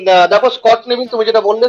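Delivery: ordinary talk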